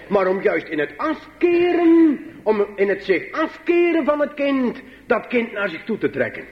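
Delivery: high, sustained pulpit call; impassioned preaching. Acted. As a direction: lift AFKEREN high and hold it. Preekt met volle kracht. (vurig preken, hoog en langgerekt)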